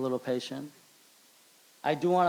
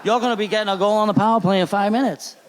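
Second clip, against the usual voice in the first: laughing through it, louder and higher; teammate's explanation; 6 semitones higher, 6 dB louder than usual. Retold or imitated. Retelling teammate's punchline to the crowd, laughing and louder. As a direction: crack up while retelling the punchline. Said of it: "He goes".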